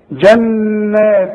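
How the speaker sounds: جنات slowly with the tanween nasal before ت; slow demo. Shown as a demonstration. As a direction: draw out جنات. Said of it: "شوف".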